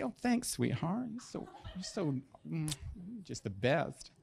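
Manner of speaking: soft, slow, sugary sarcasm; sarcastic wife. Retold or imitated. Wife answering her husband's joke, sweetly sarcastic. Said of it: "And then she'd say".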